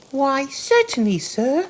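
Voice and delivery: high, small mouse voice, politely deferent; respectful field mouse. A narrator's reading. Field mouse answering the Rat, respectful and high. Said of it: "replied the field mouse respectfully".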